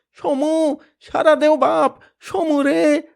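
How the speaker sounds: very high, loud desperate call; father's frantic cry. Acted. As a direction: call your son in the dark. Father calling out for son.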